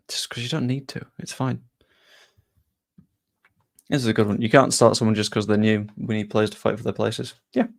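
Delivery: usual speaking voice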